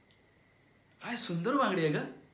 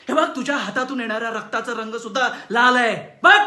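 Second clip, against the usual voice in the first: very loud, higher shouting; furious shout; 4 semitones higher, 11 dB louder than usual. Acted. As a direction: roar it at her. Shouting about her blood. (बांगडी फोडून बायकोवर संतापाने ओरडणे)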